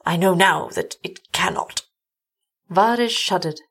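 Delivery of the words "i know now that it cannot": ordinary delivery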